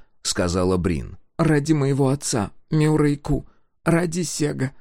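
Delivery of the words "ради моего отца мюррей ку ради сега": put-on high girl through gritted teeth. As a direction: force it out through clenched teeth. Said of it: "выговорила сквозь зубы Сол".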